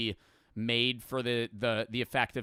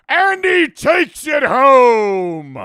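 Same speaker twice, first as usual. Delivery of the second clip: loud, high, slow hammed-up play-by-play; mock sports announcer; 13 semitones higher, 18 dB louder, much slower than usual. Parodying how football commentators talk, in a booming mock call. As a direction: stretch it out like a stadium caller. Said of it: "they'd be like".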